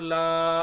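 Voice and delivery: "ला" held out as a long vowel; stretched ला. Shown as a demonstration. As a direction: draw "ला" out long. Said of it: "अलिफ लगता है तो खींचते हैं". ("ला" को लंबा खींचकर)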